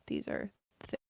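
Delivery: whispering. Real, not acted. Quiet breathy close.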